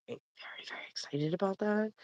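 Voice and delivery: hushed excited whisper. Real, not acted. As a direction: whisper it with glee. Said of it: "whisper about being excited".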